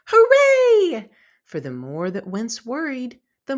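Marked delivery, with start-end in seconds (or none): 0.1-1.1 very high, loud cheering shout; triumphant high yell of a greedy bug. A narrator's reading. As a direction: cheer it out at the top of the voice. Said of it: "the worry bug shouted".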